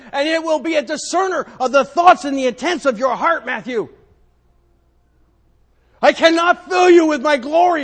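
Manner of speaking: high, loud preaching in God's voice; full-force sermon voice. Acted. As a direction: thunder it out as God calling Matthew up.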